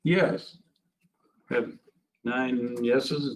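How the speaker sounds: usual speaking voice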